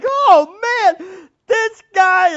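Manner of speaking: loud, high exasperated groan; like you can't believe what you heard; exasperated disbelief. Retold or imitated. Preacher recalling his reaction to the sinless preacher, exasperated. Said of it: "I'm like".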